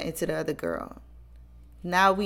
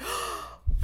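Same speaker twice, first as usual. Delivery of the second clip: imitated gasp; 5 dB quieter than usual. Friend hears the news.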